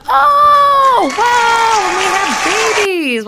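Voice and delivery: high, loud hammed-up surprise; over-the-top thrilled cry; mock excited king. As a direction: shriek it like a delighted fool of a king. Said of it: "he's like".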